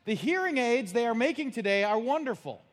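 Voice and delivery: high, loud mock wife voice from the kitchen; lightly hammed-up wife shouting; wife calling back. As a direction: call back cheerfully from the other room. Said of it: "His wife said".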